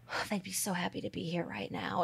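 soft, choked-up mock weepiness; imitating teary dad. Dad remembering his late parents at Christmas, softly tearful. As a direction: quietly act close to tears. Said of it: "look up all full of tears".

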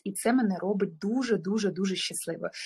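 usual speaking voice

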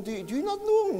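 put-on high-pitched, disbelieving girl's question; imitated young lassie. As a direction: mimic a young girl, voice leaping up high. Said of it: "three young lassies saying".

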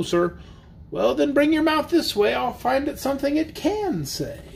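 lively sing-song read-aloud; reading to children. Acted. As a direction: read the rhyme playfully to kids. Reads tongue-twister book aloud.

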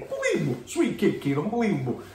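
squeaky amazed unbelievable; high catchphrase. Acted. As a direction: float it up high and soft.

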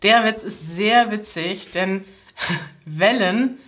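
ordinary talk, nothing marked

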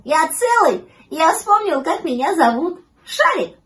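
high, loud jubilant pup; overjoyed puppy shouting; delighted puppy. A narrator's reading. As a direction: build to a long, high cheer. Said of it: "обрадовался щенок".

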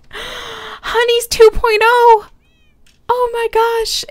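high, loud and slow drawn-out cheer; ecstatic cheer. Real, not acted. Gamer announcing the new cat's name, drawn-out cheer. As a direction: stretch it out in pure joy. Naming the tamed cat.

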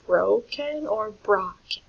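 BROKEN with long oh, BROCKEN with short ah, slow and loud; O sound then ah. Shown as a demonstration. Teacher showing two chunkings, slow contrasting pronunciations. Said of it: "O sound or an ah sound".

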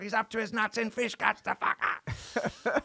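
high-pitched, hammed-up accusation; high exclamation, last words drawn out; mock outraged rant. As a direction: rant it like a scandalised Aussie bloke. Colin ranting in the story.

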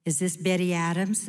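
ordinary delivery

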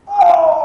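long, high, very loud "Oh!"; frustrated yell. Retold or imitated. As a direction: yell out your pent-up frustration. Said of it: "I yelled right out in the car".

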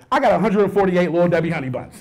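normal talking voice

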